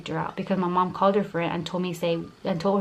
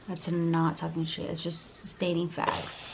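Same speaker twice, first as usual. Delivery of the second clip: usual speaking voice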